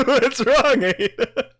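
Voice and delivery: teasing through giggles; stifled giggles. Real, not acted. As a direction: giggle while asking what's wrong. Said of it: "It hurts laughing".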